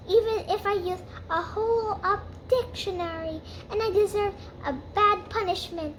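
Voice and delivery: put-on high, overwrought girlish apology; dramatic apology. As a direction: ham up a tearful-sounding apology.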